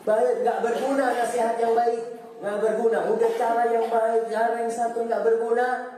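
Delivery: high, ringing sermon peak; full-force mosque preacher; impassioned preaching. Acted. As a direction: preach it out with rising fire. Fiery preaching stretch.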